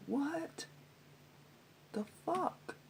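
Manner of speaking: high and soft, rising in disbelief; hushed high what-the-fuck; stunned disbelief. Retold or imitated. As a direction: let it float up, quietly stunned. Stunned by the accusation.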